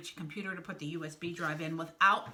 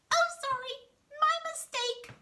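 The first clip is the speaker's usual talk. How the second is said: very high, loud cartoon apology; pitched-way-up character voice; squeaky apology; 4 dB louder than usual. Snake backs down after insult.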